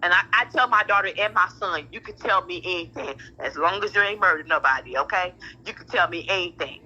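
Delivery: normal talking voice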